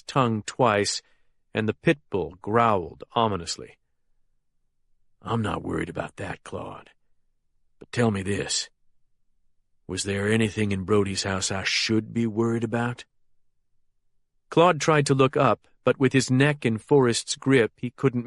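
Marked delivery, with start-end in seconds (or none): 5.2-13.0 low, soft and dangerous; quiet threat with the name dropped low; menacing boss voice. A narrator's reading. Forrest presses Claude.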